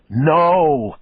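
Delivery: loud, high shout of refusal; yelled back. A narrator's reading. Detective yelling back at the killer, loud refusal. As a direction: shout him down. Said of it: "I yelled back".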